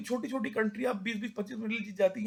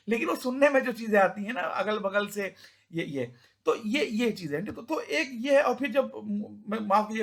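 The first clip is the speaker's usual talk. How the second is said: usual speaking voice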